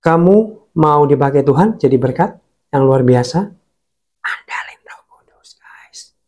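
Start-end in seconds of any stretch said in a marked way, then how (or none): 4.2-6.1 hushed unvoiced whisper, much softer. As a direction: lean in and breathe it like a secret. Preacher's confiding closing hush.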